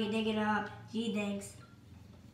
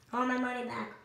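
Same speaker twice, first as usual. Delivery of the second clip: ordinary delivery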